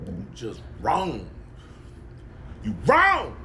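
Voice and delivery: usual speaking voice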